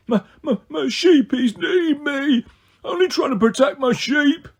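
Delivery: high, slow blubbering giant; sobbing plea. A narrator's reading. Cyclops sobbing to Percy about his sheep. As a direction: sob out the words for your sheep. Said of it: "He began to sob".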